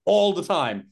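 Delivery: ordinary talk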